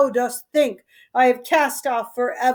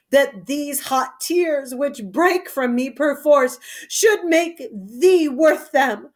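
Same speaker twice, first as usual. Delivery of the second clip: ordinary talk, nothing marked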